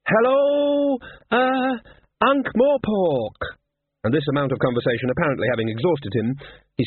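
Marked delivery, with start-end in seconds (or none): 0.1-3.4 high, hesitant, nervous greeting; frozen boy squeaking to the crowd; stage fright. A narrator's reading. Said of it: "paralysed by the attention".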